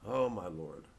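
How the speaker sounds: usual speaking voice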